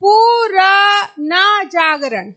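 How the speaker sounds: पुराना जागरण read very slowly as two separate words; teacher demonstrating a misreading; deliberately wrong reading. Shown as a demonstration. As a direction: stretch पुराना जागरण to show the mistake. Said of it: "हम कैसे पढ़ेंगे".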